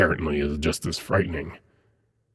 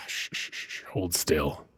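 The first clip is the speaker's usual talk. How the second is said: soothing hush; 9 dB quieter, slower than usual. Acted. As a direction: softly shush them still. Demon soothing a wounded angel.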